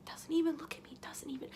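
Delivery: whispered disbelief, trailing off; small, wounded whisper; hurt whisper. Acted. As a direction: whisper it as if crushed. Retelling being ignored, in hurt disbelief.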